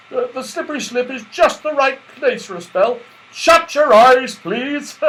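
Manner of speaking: high, loud cheerful reassurance; jolly Santa. A narrator's reading. Santa cheerfully assuring Joe, booming jolly voice. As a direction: laugh off the worry merrily.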